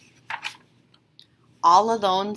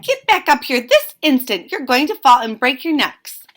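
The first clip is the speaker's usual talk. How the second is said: high, loud frantic scold; alarmed scolding; 5 semitones higher, 8 dB louder than usual. A narrator's reading. Mama Bird yells at the babies to climb back, loud alarmed scold. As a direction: yell the children back to safety.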